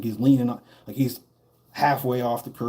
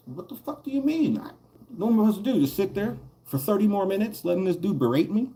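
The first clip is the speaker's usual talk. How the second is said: much higher, incredulous protest; outraged disbelief; 9 semitones higher than usual. Retold or imitated. Answering the bully's aunt, high and incredulous. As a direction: defend yourself in disbelief. His outraged reply to the auntie.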